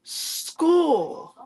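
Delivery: school said whole and clear, starting high; showing a child the full word school; word put together. Shown as a demonstration. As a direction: blend the sounds into school. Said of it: "it's gonna say".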